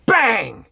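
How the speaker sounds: high loud vocal bang; gunshot sound. Acted. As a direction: spit out the shots. Gunshot effect.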